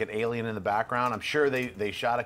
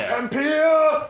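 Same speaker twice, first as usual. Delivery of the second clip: loud call; 10 dB louder than usual. Acted. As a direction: shout the name much higher and louder. Calling out to absent host.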